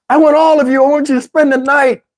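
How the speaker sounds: high-pitched, pleading feminine voice; imitating yearning woman. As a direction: play her longing, pitched well up. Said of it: "I'm gonna do her voice exactly how she said".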